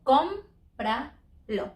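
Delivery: slow cómpralo with CÓM leaned on; CÓM-pra-lo, stretched and careful; stress on first syllable. Shown as a demonstration. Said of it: "we do it in co".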